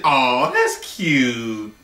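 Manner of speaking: mock-sweet, drawn-out aww; patronizing coo. Date brushing off a kiss on the cheek, patronizing coo. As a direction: coo it slowly like at a puppy. His feeble reply to a kiss.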